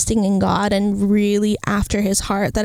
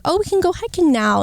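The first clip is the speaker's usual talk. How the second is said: excited; 8 semitones higher than usual. Retold or imitated. Excited plan retold.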